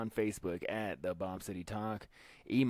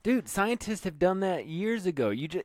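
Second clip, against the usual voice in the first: excited disbelief; 10 semitones higher, 8 dB louder than usual. Real, not acted. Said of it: "excited disbelief".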